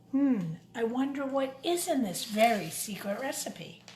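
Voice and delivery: usual speaking voice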